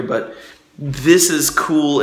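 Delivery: ordinary talk, nothing marked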